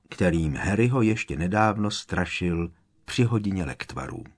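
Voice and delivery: ordinary delivery, nothing marked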